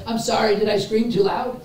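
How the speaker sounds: high-pitched polite mock concern; mock apology. Preacher imagines the screaming crowd apologizing, mock concern.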